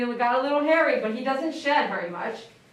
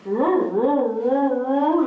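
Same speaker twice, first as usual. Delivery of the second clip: loud, higher howling roo-roo; playful dog-bark impression; imitating dog's bark; 3 semitones higher, 7 dB louder, much slower than usual. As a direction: bark like a dog in long, swooping roos. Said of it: "His bark is like".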